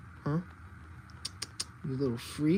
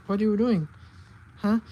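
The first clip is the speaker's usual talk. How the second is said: ordinary talk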